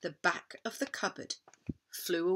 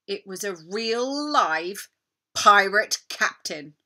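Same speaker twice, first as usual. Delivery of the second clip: slow, loud dramatic reveal; big reveal; 8 dB louder, slower than usual. A narrator's reading. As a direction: build to the reveal. Big reveal after hush.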